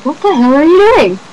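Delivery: high, loud retold scream; loud mock scream. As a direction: yell it as the sister translates the scene. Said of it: "and then screamed".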